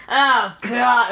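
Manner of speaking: put-on fed-up groan; eye-rolling exasperation. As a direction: roll your eyes through the words. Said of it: "roll my eyes".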